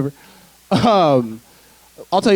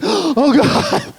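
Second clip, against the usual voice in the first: gasp, then high-pitched horror; mock shock; 11 semitones higher than usual. Comic hearing the ladies repeat it, in mock shock. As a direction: suck in air and squeak it in dismay.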